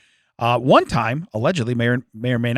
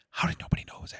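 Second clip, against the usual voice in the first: whisper; 15 dB quieter, faster than usual. Pretend. Acted shocked discovery in basement.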